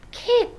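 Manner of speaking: "kit" for cat, pitched high and loud; struggled American pronunciation. Shown as a demonstration. Practising a hard word, high effortful "kit". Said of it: "have a hard time saying kit".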